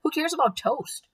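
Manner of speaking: high, exasperated grumble; grumbling. A narrator's reading. Worried Eugenia dismissing an offer of toast, grumbling. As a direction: scoff at the very idea. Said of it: "grumbled Eugenia".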